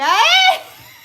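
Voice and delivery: high, loud burst of laughter; sudden screaming laugh; loud shrieking laugh. Real, not acted. Cracks up at viewer joke.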